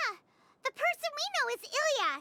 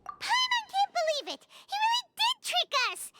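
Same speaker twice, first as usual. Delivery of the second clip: indignant shout; 7 semitones higher than usual. Acted. Paimon shouting in fury about being tricked. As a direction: yell it out, fuming at the trickster.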